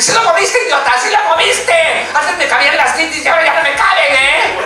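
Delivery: high bossy woman's voice; Rasputia. Retold or imitated. Rasputia scolding Norbit, high bossy sass. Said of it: "grabar a Rasputia diciéndole".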